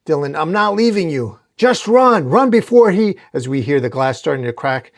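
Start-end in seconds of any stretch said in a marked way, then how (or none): 0.4-1.4 high, insistent protest; raised, urgent voice; desperate refusal. A narrator's reading. Boy refuses to flee. 1.6-3.2 high, loud plea to run; frantic raised urgency; desperate shout. A narrator's reading. Begging the boy to run.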